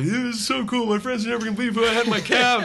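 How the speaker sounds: high-pitched, giddy fan imitation; delighted fan. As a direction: gush like a thrilled young driver. Imitating the delighted young cab driver.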